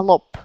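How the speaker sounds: "lop" with a voiceless P; "lop" ending on P; final P. Shown as a demonstration. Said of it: "le son normal".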